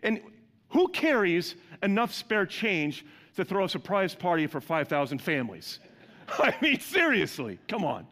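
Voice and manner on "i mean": laughing. Real, not acted.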